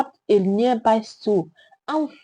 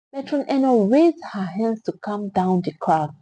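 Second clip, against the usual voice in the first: usual speaking voice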